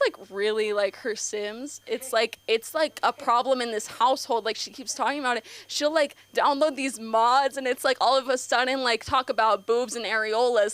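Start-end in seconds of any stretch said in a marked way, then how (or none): none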